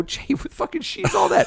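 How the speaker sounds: mock your friend for owning the tape; mock teasing. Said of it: "I go".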